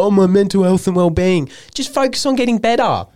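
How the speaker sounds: sarcastic imitation of an excuse; mock them in a whiny voice; mocking whine. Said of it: "People go".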